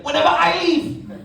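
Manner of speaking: high, drawn-out Caribbean holler; rowdy island-accented declaration; Caribbean bus driver. Acted. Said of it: "you ask the guy next to you".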